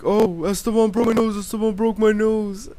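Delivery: high, loud whining wail; mock crying. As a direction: imitate a hurt kid bawling out a complaint. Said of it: "he was crying for his older brother".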